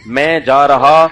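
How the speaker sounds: very slow, careful, well-mannered speech; polite slow diction. Shown as a demonstration. Pupil addressing the qari with slow proper diction. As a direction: ask politely, drawing out each word. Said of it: "ऐसे बोला करो तमीज से".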